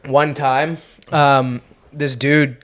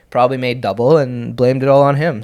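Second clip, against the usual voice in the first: normal talking voice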